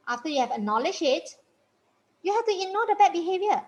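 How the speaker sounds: usual speaking voice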